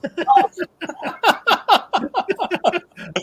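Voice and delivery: long, high burst of laughter; real hearty laugh. Real, not acted. Business owner amused by friend's repo man offer, laughing. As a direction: laugh out loud, high and sustained. Joking offer to bust out trucks.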